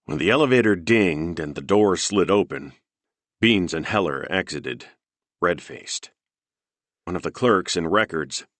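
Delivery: ordinary delivery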